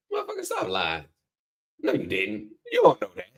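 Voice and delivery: high-pitched imitation of a doubting agent; lightly voiced agent calling him a liar; a hint of an incredulous official; mock disbelieving agent. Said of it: "That's how he sounded".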